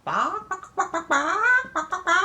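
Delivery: high-pitched imitated hen squawks; chicken clucking. Grandma showing grandchild how the chicken goes, clucking. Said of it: "And the chicken goes".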